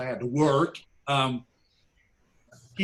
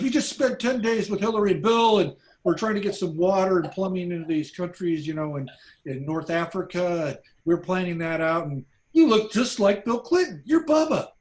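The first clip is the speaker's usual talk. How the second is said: higher and louder, building to a high peak on Bubba; excited insistence; 3 semitones higher, 3 dB louder than usual. Retold or imitated. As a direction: gush it, climbing to the punchline. Quincy excitedly insists he's Bill.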